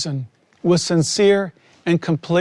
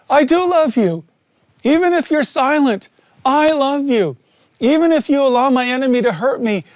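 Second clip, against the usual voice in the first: much higher, fervent declaration of love; triumphant devotion; 7 semitones higher than usual. Retold or imitated. Prisoner realizing his victory, declaring love to God, higher and fervent. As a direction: lift it like a breakthrough you can't hold in.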